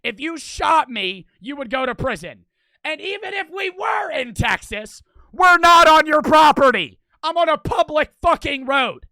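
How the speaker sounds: loud, high, indignant tirade; screaming at someone. Retold or imitated. Teller screaming at the gunman's house, angry tirade. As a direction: yell each point toward a distant house. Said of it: "I'm screaming this to his house".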